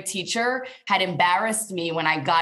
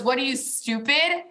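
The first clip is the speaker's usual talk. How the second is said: contemptuous; 6 semitones higher than usual. Retold or imitated. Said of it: "what she said was".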